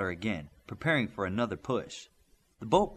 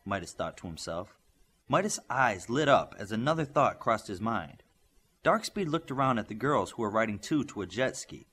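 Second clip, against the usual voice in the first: plain reading voice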